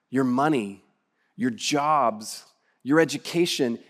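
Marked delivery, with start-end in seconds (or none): none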